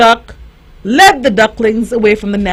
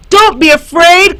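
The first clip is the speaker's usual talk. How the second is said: higher, louder call across the water; 6 semitones higher, 4 dB louder than usual. A narrator's reading. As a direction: call out to reassure him. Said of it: "called Mother Duck".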